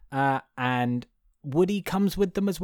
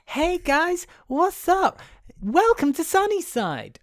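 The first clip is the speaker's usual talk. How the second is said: much higher sing-song greeting; cheery welcome; slower than usual. Retold or imitated. Host voices the Sunnyside toys welcoming, high and chirpy. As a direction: greet them high like a too-eager host. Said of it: "they're like".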